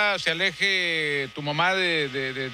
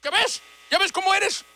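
angry scolding; 11 semitones higher than usual. Acted. The fake father scolds the young man, angry and high-pitched. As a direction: scold him hard, pitched way up.

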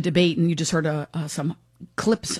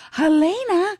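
much higher drawn-out crowd call; imitating the crowd; 11 semitones higher, slower than usual. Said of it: "you hear the crowd go".

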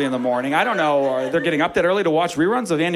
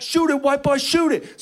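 high yelled street voice; teammate yelling; 10 semitones higher, slower than usual. Retold or imitated. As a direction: bark it high across the court.

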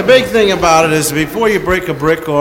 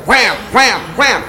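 hammering chant; 6 semitones higher, slower than usual. Retold or imitated. Hammering sound words.

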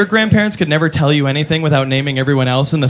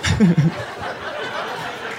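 airy unvoiced chuckle; breathy laugh; 6 dB quieter than usual. Real, not acted. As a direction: silent wheezing laugh. Comic laughs at own joke.

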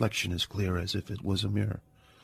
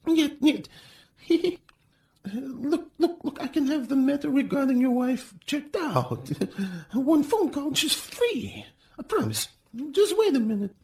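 very high, jittery laughing plea; frightened official begging the hero to wait; nervous pleading; 3 dB louder than usual. A narrator's reading. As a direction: babble and fawn to stop him.

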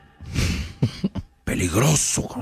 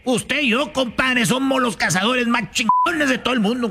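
high compadre voice; 10 semitones higher than usual. Acted. Cazador voiced in the joke.

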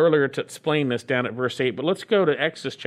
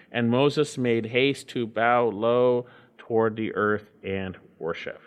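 low, measured Bible reading; scripture reading; 5 semitones lower than usual. Acted. Pastor reading Exodus to his church, low and solemn. Reads Exodus passage aloud.

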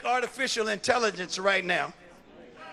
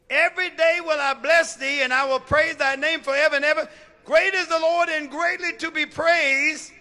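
high, loud, ringing verse; preached scripture reading; 8 semitones higher, 9 dB louder than usual. Real, not acted. Preacher reads David's psalm aloud, full-voiced preaching. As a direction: declaim the verse to the congregation.

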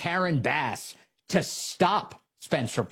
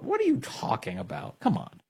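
normal talking voice

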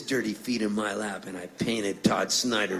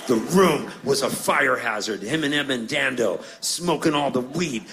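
ordinary talk, nothing marked

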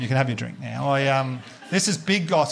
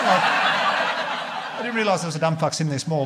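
ordinary talk, nothing marked

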